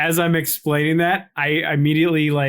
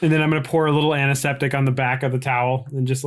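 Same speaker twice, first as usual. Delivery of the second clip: ordinary delivery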